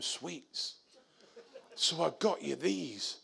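quiet sheepish apology; soft apologetic. Retold or imitated. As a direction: mumble it like a sorry friend. Friend's apologetic retold explanation.

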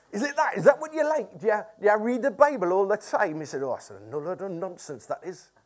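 hammed-up Morpeth accent, falling away at the end; Morpeth builder's accent. Preacher mimicking campsite owner scoffing at Bible, Morpeth accent. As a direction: imitate a sceptical Morpeth local. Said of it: "keep doing this accent".

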